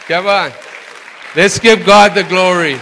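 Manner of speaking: loud, high call to the congregation to cheer; rallying call. Real, not acted. Pastor calling the church to praise, loud and high. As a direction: lift the room into applause, full voice.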